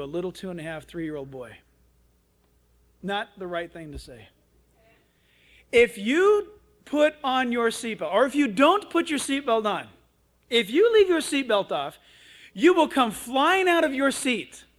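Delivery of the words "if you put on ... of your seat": emphatic raised-voice scolding; threatening mother. Retold or imitated. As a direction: imitate a mom spelling out a dire consequence. Mother threatens boy, retold.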